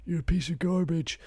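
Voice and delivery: light mock Biden; Joe Biden impression. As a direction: put on a doddery old president voice. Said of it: "He's like".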